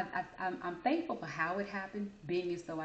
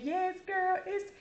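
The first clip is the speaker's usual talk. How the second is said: high, loud laughing yes; laughing friend; 11 semitones higher, 5 dB louder, slower than usual. Retold or imitated. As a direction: laugh it out to her.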